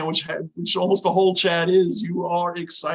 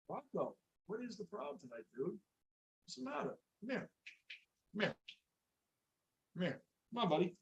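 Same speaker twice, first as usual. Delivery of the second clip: hushed coaxing to a restless dog; soft dog talk; 19 dB quieter, faster than usual. Real, not acted. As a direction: murmur to calm the dog down. Talking to his restless dog.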